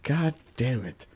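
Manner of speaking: ordinary delivery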